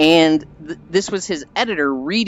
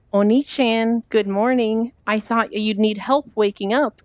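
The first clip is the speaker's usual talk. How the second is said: higher-pitched anime little-sister voice; mock cutesy sister, pitched up throughout; sweet little sister; 6 semitones higher than usual. Little sister voiced in retold scene.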